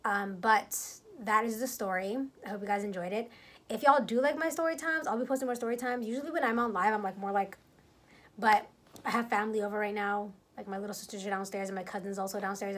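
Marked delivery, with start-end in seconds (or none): none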